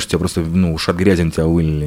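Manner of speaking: normal talking voice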